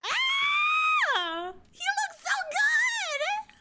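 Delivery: high-pitched delighted shriek, slow and drawn out; fangirl squeal. Real, not acted. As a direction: squeal over how good he looks. Fangirling over [name]'s transformation.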